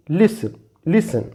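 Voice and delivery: "listen" said slowly as "lissen", T not sounded; slowly drawn out "listen" with a silent T. Shown as a demonstration. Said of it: "when T is silent". ("listen" में T silent, धीरे "लिसन")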